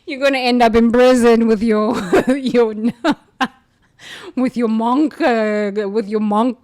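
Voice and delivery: can't get it out for laughing; laughing at the fake monk's coming prison term. Real, not acted.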